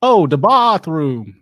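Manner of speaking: very slow "the bathroom" with a broad ah, put-on accent; mock Australian. Shown as a demonstration. Husband imitating wife's accent, slow mock Australian. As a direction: stretch out an Aussie "the bathroom". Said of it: "not bad".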